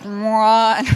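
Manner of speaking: a loud, mock hum of an old TV warming up; old TV switching on. Imitating the old ultrasound screen powering on with a loud mock hum. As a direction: voice the buzzy click of an ancient set. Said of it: "comes on like one of those old seventies T Vs".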